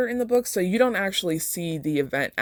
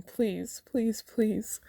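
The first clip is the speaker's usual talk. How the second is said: sobbing plea; 6 semitones higher, 5 dB quieter, much slower than usual. Acted. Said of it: "Her words dissolved in the sobs".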